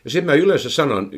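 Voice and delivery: usual speaking voice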